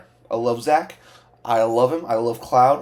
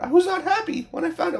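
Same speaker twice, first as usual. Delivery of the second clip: squeaky high disappointed whine; high-pitched complaint; faster than usual. Real, not acted. Repeats disappointment, voice jumps up.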